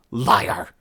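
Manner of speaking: a loud spat-out insult; spat accusation. A narrator's reading. Shipmaster rejects the human's claim, spitting the word loudly. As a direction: spit the word at him. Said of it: "Herkurtzel spat".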